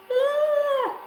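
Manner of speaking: mock shriek. Mock alarm at her face squish.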